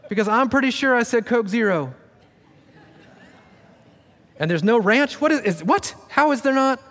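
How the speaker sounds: high, incredulous sputtering; mock indignation. Diner griping to the waiter about no ranch. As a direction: act out the outraged diner. Mock restaurant complaint continues.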